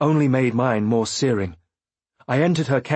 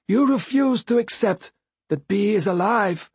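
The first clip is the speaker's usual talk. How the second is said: higher, wounded voice for the Fool; bitter Fool voice; 10 semitones higher than usual. A narrator's reading. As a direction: voice the Fool, resentful. Fool's bitter dialogue.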